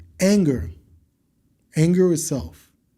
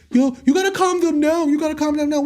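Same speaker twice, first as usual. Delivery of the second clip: much higher whiny mock voice of people saying calm down; mocking others; 12 semitones higher, faster than usual. To viewers, mimicking people who say calm them down, high mocking voice. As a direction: parody the nagging friend, pitched way up. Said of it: "the first thing everybody's saying".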